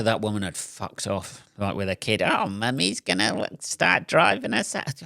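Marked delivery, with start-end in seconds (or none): 2.2-5.0 higher, sing-song parent voice; mock baby-talk mummy. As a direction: coo like a doting mum. Mocking the mother again.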